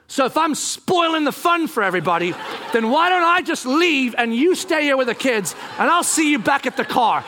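high, petulant sarcasm; huffy retort. Retold or imitated. Husband walking out on wife, huffy retort. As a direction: storm off in a sulk.

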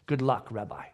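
low, quiet dismissal; soft, deep-pitched brush-off; dismissive aside. Acted. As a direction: throw it away under your voice. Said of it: "I laughed and said".

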